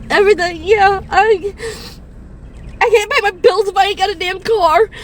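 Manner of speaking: ordinary delivery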